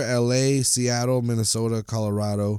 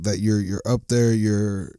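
ordinary delivery

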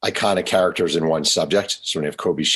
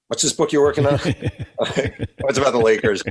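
high, slow, laughing voices; laughing through quotes; 7 semitones higher, slower than usual. Retold or imitated. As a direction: retell it while cracking up. Laughing retelling of JR exchange.

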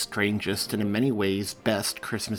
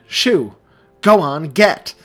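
much louder, sharp command; commanding shoo; 6 semitones higher, 11 dB louder, slower than usual. A narrator's reading. Seamus shooing the dog and cat, loud. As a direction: shoo the animals off. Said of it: "he commanded".

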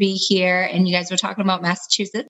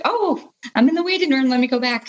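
much higher on a surprised oh; mild startled realisation; 7 semitones higher than usual. Retold or imitated. Guest retells hearing she's in the waiting room, startled. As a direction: re-enact a sudden, high-pitched realisation.

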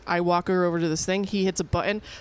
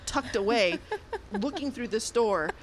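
ordinary delivery

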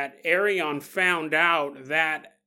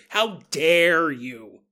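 mock outrage; 5 dB louder, much slower than usual. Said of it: "she was furious".

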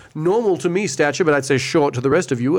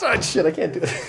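laughing; 2 semitones higher, 3 dB quieter than usual. Real, not acted. Breaking character over failed accent.

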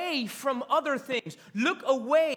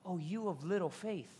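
low, soft reproach; gentle rebuke; 4 semitones lower, 9 dB quieter than usual. Acted. Jesus speaking to Peter after rescuing him, gently. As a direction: say it tenderly, almost sighing.

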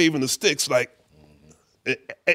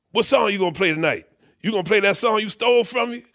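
high, fast, accusing mimicry; imitated star's pointed question, pitched high; 6 semitones higher, faster than usual. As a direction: needle him in a put-on voice. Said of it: "He's like".

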